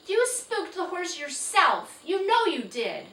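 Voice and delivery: high, loud defiant call. Acted. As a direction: shout back in defiance. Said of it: "Felicity called out".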